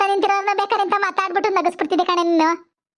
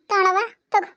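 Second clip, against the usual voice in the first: choked up and tearful; tearful plea while handing over; crying; 2 semitones higher than usual. Acted. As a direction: speak through tears, voice catching. Tearful daughter at father's memorial.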